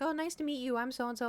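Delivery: high, sociable imitation of a neighbour; polite greeting.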